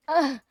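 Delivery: loud frustrated groan. Real, not acted. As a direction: let out a groan into the mic. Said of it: "I just moaned into the mic".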